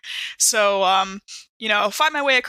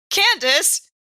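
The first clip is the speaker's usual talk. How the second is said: mock outrage; 9 semitones higher than usual. Real, not acted. Player exclaims at hated NPC.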